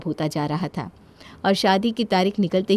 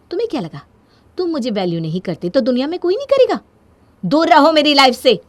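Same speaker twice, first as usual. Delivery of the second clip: higher, building to a loud outburst; angry confrontation; 7 semitones higher, 6 dB louder, faster than usual. Acted. Telling someone to stay out of their life, angry. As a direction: lash out, louder toward the end.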